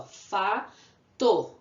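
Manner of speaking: Fato split as a long Fa, then to; syllable by syllable. Shown as a demonstration. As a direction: leave a gap between Fa and to. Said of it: "Tem duas sílabas".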